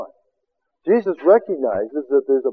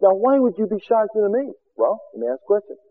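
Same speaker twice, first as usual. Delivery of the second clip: normal talking voice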